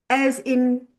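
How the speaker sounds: ordinary delivery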